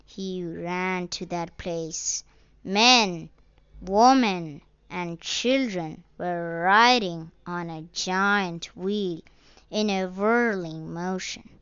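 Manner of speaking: usual speaking voice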